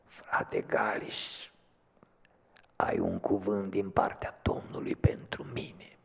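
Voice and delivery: faint, slow, breathy whisper of a dying man; weak hoarse voice. Retold or imitated. Dying brother rising on his elbows, weak hoarse whisper. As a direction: barely push the words out, like a man at death's door. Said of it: "cu o voce foarte slabă și răgușită".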